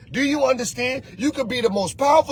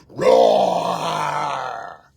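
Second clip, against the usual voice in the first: very low mock roar from a frog; 13 semitones lower than usual. Mock roar for a frog. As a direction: growl it out deep like a silly lion. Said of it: "heard a frog go".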